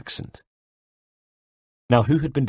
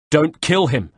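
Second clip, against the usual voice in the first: loud roar, pitched up high; urgent shouted command to hold fire; 6 semitones higher, 4 dB louder, slower than usual. A narrator's reading. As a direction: bellow it to stop the shot. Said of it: "I roared".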